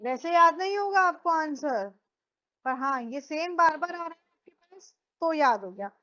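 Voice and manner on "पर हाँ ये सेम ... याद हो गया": ordinary talk, nothing marked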